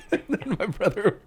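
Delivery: high laughter breaking through; laughing. Real, not acted. Retelling the story, cracking up high. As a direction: laugh your way into the line.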